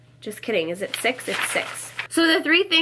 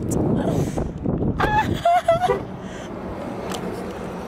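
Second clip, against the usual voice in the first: high breathy laugh. Real, not acted. Laughing in freezing wind.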